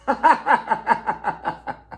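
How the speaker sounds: breathy burst of real laughter; a quick laugh, falling away. Real, not acted. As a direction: let a real laugh slip out. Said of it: "I'm laughing".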